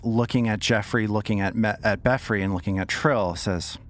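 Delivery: usual speaking voice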